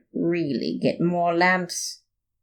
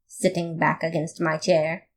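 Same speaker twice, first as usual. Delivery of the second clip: ordinary delivery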